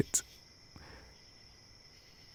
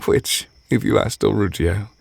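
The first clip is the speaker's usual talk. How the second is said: ordinary talk, nothing marked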